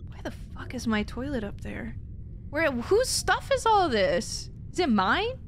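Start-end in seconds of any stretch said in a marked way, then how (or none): none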